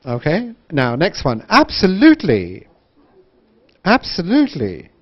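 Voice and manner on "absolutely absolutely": "Absolutely" swept high on "lute" and down; wide intonation. Shown as a demonstration. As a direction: exaggerate the swoop in "Absolutely". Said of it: "think about connected speech and intonation".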